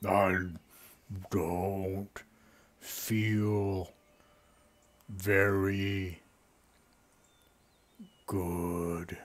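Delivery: low, very slow groaning words with long pauses; sick tree. Acted. Ailing tree answering the visitor, deep slow groan. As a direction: drag each word out deep and weary.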